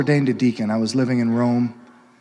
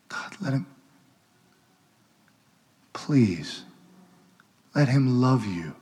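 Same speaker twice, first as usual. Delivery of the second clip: quiet pleading preaching; 2 semitones lower, 3 dB quieter than usual. Real, not acted. The priest urging listeners to accept love, softly pleading. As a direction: plead gently with the congregation.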